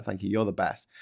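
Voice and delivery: ordinary delivery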